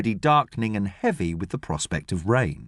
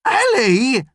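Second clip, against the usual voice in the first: loud, high cry of her name; James, shocked; 12 semitones higher, 5 dB louder than usual. Acted. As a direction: blurt her name out, half awed, half furious. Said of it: "could be admiration or could easily be fury".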